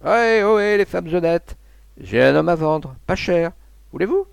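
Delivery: loud, high hail across the water; mocking call across the river. A narrator's reading. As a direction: shout brazenly from the boat to the bank. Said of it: "L'une d'elles cria vers la berge".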